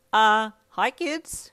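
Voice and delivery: loud, drawn-out awkward greeting; nervous. A narrator's reading. Mum walking in on an embrace, awkward nervous greeting. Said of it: "she said nervously".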